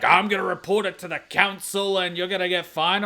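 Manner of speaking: high, loud mock complaint; complaining neighbor. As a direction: imitate a griping neighbor making threats. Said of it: "And so he's like".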